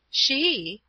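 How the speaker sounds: high, incredulous outcry; cried out. A narrator's reading. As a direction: jump on the word in disbelief. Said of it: "I cried".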